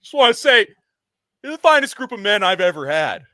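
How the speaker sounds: high, loud, tearful coach speech, hammed up; over-the-top sobbing pep talk to the players; mock weepy coach. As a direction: play a coach choking up while praising his team. Coach giving a teary locker-room speech.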